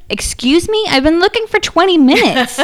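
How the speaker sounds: high-pitched, incredulous outburst; retold exasperation, pitched well up; indignant retort. Wife answering husband who found it instantly, indignant.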